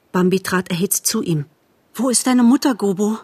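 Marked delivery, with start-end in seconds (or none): 1.9-3.2 higher, impatient question; panting, urgent. A narrator's reading. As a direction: ask urgently as if out of breath. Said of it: "fragte er mit keuchendem Atem". (aufgeregt, mit keuchendem Atem)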